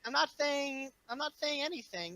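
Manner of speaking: coy denial. Real, not acted. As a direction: pretend to deny while hinting it.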